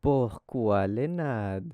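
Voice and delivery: slow, drawn-out title read; measured countdown-style heading; section title announcement. Real, not acted. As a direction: announce the heading slowly, stretching the words.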